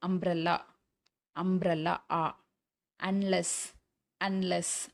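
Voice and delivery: "அம்ப்ரெல்லா", "அன்லெஸ்" opening on "a"; ஆ sound demo. Shown as a demonstration. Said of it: "அதுவும் ஆ". ("அம்ப்ரெல்லா", "அன்லெஸ்" ஆ ஒலியில் தொடங்கி)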